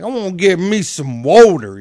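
loud mock Southern accent; imitation Southern twang. Shown as a demonstration. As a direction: exaggerate a Southerner's vowels. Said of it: "somebody from the South be like".

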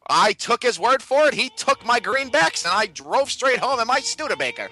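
put-on sing-song old-time radio patter; 1920s guy voice. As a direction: do a hammed-up Roaring Twenties storyteller. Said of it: "you have to talk like that".

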